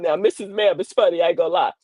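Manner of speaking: ordinary talk, nothing marked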